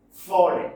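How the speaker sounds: "falling" with the first syllable loud and the second light; teacher's demo of "falling", front-stressed. Shown as a demonstration. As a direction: punch the opening of "falling". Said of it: "For first syllable is stressed".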